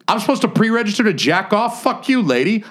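high, outraged mock rant with a laugh; indignant outburst. Telling his cohost about the lab nurse, high and indignant. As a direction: huff in disbelief at the absurd rule.

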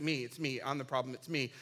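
low, matter-of-fact confession; resigned admission. Acted. Jonah confessing to frightened sailors, resigned admission.

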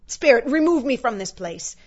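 anguished demand to the spirit; distressed plea. A narrator's reading. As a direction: plead loudly to be taken away. Scrooge pleads to escape the vision.

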